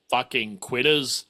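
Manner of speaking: usual speaking voice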